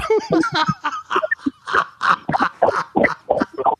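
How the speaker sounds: long, high peals of laughter; real laughing fit. Real, not acted. It made me laugh even harder, laughing hard. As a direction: laugh helplessly and keep going. Cracking up at the story.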